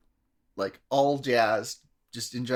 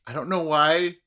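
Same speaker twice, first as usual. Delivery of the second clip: usual speaking voice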